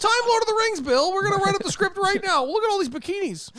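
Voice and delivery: high-pitched mock studio boss; greedy studio executive. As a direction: play a cash-hungry producer.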